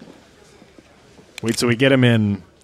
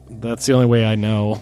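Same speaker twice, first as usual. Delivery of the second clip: ordinary delivery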